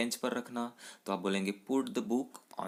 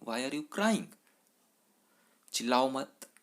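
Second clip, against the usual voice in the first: put-on higher, louder scold of a wailing kid; scolding a crying child; 4 semitones higher, 4 dB louder than usual. Teacher scolds a bawling child, raised scolding voice. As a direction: tell the child off sharply.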